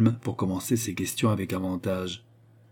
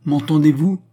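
loud, raised, sharp question; imperious demand; 8 semitones higher, 10 dB louder than usual. A narrator's reading. Queen presses silent Jeanne for reply, imperious and loud. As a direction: demand an answer forcefully.

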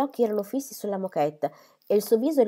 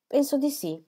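ordinary delivery, nothing marked

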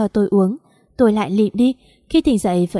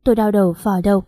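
usual speaking voice

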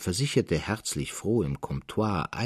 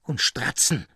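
angry shouting; 4 semitones higher than usual. A narrator's reading. Traugott to Elias Roos, furiously quitting the office. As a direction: hurl it at the old merchant in rage. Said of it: "schrie er".

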